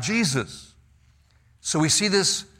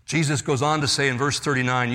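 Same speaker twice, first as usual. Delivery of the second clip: ordinary talk, nothing marked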